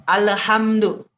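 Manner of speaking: slow teaching demo of "Alhamdu" with a hard stop on the d; crisp stopped "Alhamdu". Shown as a demonstration. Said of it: "akan seperti ini".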